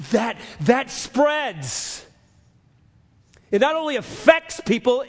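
high, loud pulpit preaching, slowing on scripture; fiery preaching. Acted. Preacher reading scripture to the congregation, full-force preaching.

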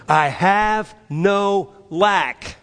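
with conviction. Shown as a demonstration. Preacher leading the church's response, with conviction. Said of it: "Say it with conviction".

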